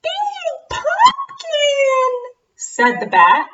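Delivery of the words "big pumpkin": very high, slow squeak; squeaky bat voice. Acted.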